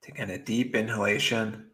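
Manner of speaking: soft, unhurried meditation voice with long pauses; guided meditation. Acted. Meditation teacher guiding beginners to drop worry, soft and calm. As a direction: ease them gently into stillness, softly.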